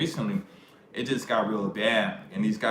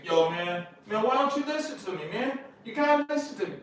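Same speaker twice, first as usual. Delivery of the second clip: high-pitched nagging imitation; mock pleading complaint; 12 semitones higher than usual. Man begging another man to listen, mock nagging. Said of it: "those are words that women say".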